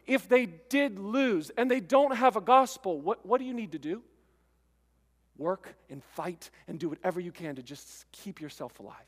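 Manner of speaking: usual speaking voice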